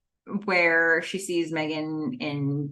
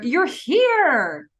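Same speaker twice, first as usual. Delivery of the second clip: high, louder mock-joyful greeting; so excited; 8 semitones higher, 5 dB louder than usual. Felicity seeing Elena alive, so excited. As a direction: exclaim in delight, acting it out. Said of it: "so excited to see Elena alive".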